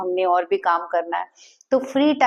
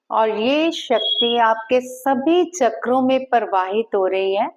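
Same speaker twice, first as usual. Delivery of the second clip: guided visualization; slower than usual. Acted. Leads visualization.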